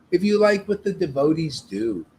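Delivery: normal talking voice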